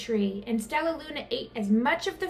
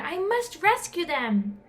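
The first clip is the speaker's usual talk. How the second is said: higher determined voice; 6 semitones higher than usual. A narrator's reading. Stellaluna determined.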